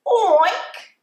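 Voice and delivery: high squealy piggy oink. Acted. Teacher making a pig noise for children, high oink. Animal noises in barnyard song.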